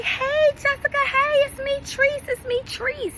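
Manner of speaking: mocking the woman's eager high hello; imitating Treese. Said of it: "And she's like".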